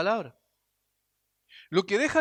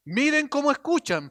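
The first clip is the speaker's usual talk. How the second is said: emphatic repeat; 6 semitones higher, 3 dB louder than usual. Shown as a demonstration. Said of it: "miren cómo escuchan la palabra".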